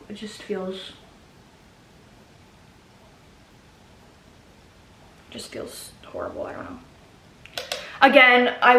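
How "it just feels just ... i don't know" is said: choked whisper. Real, not acted. Grieving lost son, voice fades.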